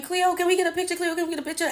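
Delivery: high-pitched mock fan clamour; screaming fans. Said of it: "The fans are screaming".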